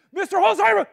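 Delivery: high, very loud cry of alarm; alarmed shout. Retold or imitated. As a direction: shout the driver's name in sudden panic. Passenger screams alarm at driver.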